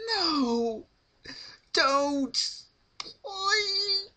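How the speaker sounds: very high, loud sobbing plea; crying plea. A narrator's reading. Rarity crying to Pinkie to stop, high sobbing plea. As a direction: cry out begging her to stop.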